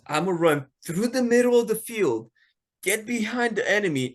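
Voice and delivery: excited Houdini. Retold or imitated. Houdini quoted, excited plan.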